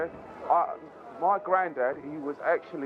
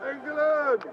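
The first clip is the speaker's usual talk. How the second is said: shouted England; 11 semitones higher than usual. Acted. Cheering for the photo.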